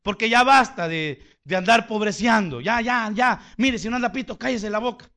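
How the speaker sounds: loud, driving preacher's voice; forceful preaching. Acted. Preacher scolding the congregation to learn, loud preaching.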